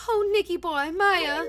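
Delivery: Miss Delight voice. Acted. Voicing Miss Delight greeting.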